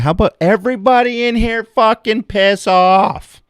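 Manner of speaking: high, loud angry yell; angry outburst. Retold or imitated. To the waitress about the patrons, high angry yell. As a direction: let it fly at the whole room.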